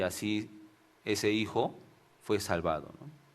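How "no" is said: normal talking voice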